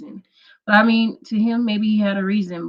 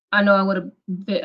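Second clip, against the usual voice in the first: ordinary talk, nothing marked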